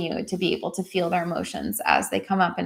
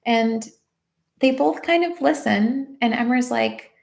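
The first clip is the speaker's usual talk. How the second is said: ordinary talk, nothing marked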